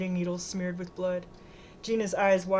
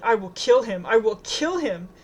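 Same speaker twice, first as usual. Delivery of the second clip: loud fury, slowing on the repeat; forceful, furious threat; outraged vow; 3 semitones higher, 8 dB louder than usual. A narrator's reading. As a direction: swear vengeance with heavy force. Friend vows revenge in outrage.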